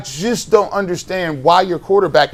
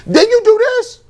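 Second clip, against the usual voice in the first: much higher, fast, near-squeaking outrage; exasperated disbelief; 4 dB louder than usual. Real, not acted. Host ranting at the coach, voice shooting up in exasperated disbelief. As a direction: voice jumps way up in disbelief.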